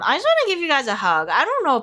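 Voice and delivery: real high, emotional surge; choked up. Real, not acted. Tarot reader wanting to hug her viewers, choked up. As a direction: voice rising as tears threaten. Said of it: "getting so emotional for your pile".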